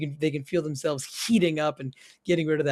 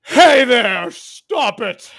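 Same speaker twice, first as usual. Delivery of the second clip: loud, high drawn-out holler; big acted warning shout; mock bellow; 4 semitones higher, 10 dB louder, much slower than usual. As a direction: bellow it across the stream to scare off a beast. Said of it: "You begin doing a bellowing".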